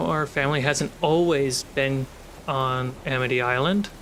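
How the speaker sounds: normal talking voice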